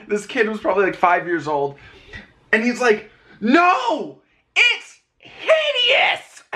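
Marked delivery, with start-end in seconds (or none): 3.4-6.4 much higher, stretched-out imitated child yell; mock kid's scream. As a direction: scream it like a horrified five-year-old. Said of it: "He screams it at the top of his lungs".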